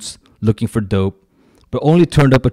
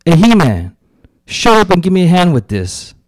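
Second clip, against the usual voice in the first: loud, raised call across the yard; loud, higher shout to a friend; yelling; 8 semitones higher, 6 dB louder than usual. A narrator's reading. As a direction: yell at him to shut up and help. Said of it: "who yells".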